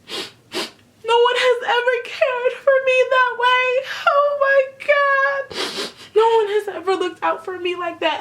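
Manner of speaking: high, wailing sob-talk, slowed right down; hammed-up weepy gush over a bottle of water; exaggerated sobbing imitation; mock crying. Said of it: "and she's like".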